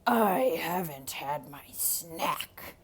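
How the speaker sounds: slow loud start, sinking to a whisper; menacing wolf. Acted. Wolf to Rico, slow menace ending in a whisper. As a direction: growl then hiss the threat.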